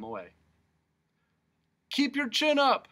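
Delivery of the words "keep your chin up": very high, loud cheering call; shouted. Acted. Humbug calls after Milo being led away, shouting. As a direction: call out encouragement. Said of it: "shouted the humbug".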